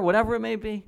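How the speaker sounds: ordinary delivery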